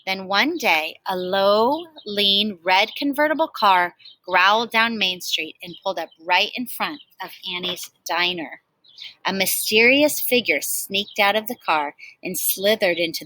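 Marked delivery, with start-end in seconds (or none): none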